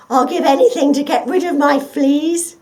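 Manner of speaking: desperate to be rid of fleas; pleading. Acted. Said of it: "pleaded the dog".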